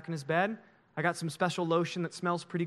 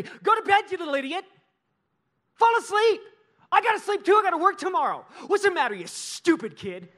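much higher, louder furious yelling; angry dad; 11 semitones higher, 6 dB louder than usual. Retold or imitated. Harsh father yelling at his child who cannot sleep, furious and loud. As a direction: bark at the kid in a rage. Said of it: "And dad will say".

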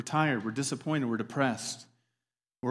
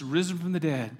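ordinary talk

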